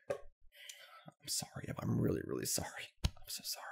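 slow, soft unvoiced whisper; whispered apology. Gamer apologizing to viewers for the loss, whispering. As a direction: whisper it like a secret confession.

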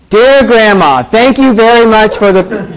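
loud, high declaiming; wrong letter-reading voice. Shown as a demonstration. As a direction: belt it out to the back of the room. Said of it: "you don't say it that way".

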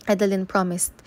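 plain reading voice

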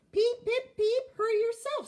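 high, loud cheeky peeping; mock the big engine right back. A narrator's reading. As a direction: Thomas teasing Gordon with cheeky peeps. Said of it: "said cheeky Thomas".

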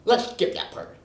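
ordinary delivery

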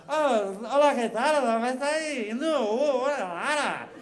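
put-on high, singsong garbled Argentine accent; mock Argentine Spanish. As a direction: babble like a caricatured Argentine. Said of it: "o hermano começa a falar".

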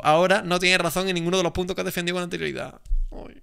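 high-pitched, dismayed complaint; exasperated lament. Real, not acted. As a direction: plead in disbelief at a blunder. Laments opponent conceding the point.

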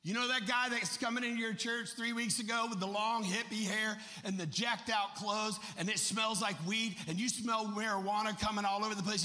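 loud rapid-fire sermon storytelling; full-force preaching. Real, not acted. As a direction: preach it hard to the congregation.

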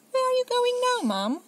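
put-on very high, loud questioning kid; child demanding to know. Laura calls after mum, high and loud.

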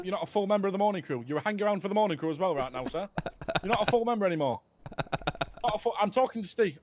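usual speaking voice

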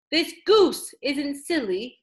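usual speaking voice

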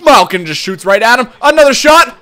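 rising, loud commentator call; excited play-by-play. Real, not acted. Gamer calling Malkin's shots, excited. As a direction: build loudly as the shots come.

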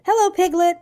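high squeak of a little joey; squeaky. A narrator's reading. Roo greeting Pooh at the party, high squeak. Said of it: "he squeaked".

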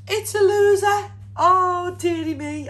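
mock dismay. Card loses.

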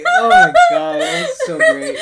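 high squeal through laughter; real laughter. Real, not acted. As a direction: barely get it out for laughing. Cracking up at the botched line.

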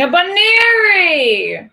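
excited announcement. Real, not acted. Announcing a gift to viewer.

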